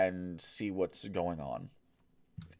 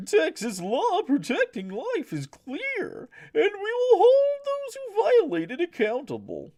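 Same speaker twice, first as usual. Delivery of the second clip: very high, slow, loud clown voice; 9 dB louder, slower than usual. Acted. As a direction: read it like a goofy circus clown. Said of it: "I'm gonna read this with a clown voice".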